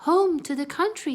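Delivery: high loud mouse squeak. Acted. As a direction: squeak while running off. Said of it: "squeaked Annie".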